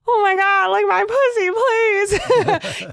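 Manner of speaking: high, whiny mock plea; retold begging, squeaky and urgent; desperate begging. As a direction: plead in a hammed-up, high-pitched wail.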